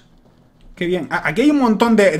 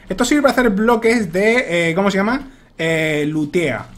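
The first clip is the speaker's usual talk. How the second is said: ordinary talk, nothing marked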